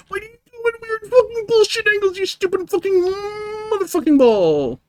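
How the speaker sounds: rapid high-pitched enraged tirade at the game; furious high shriek. Real, not acted. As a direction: shriek the curses at the ball in full rage. Raging at the ball's angles.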